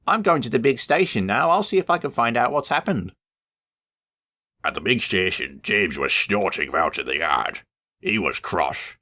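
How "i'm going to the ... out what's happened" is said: a higher, eager Thomas voice; the small tank engine, keen to help; cheerful little engine. Acted.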